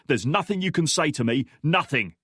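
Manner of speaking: furious, hammer the word nothing; snarling. Acted. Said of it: "he snarled".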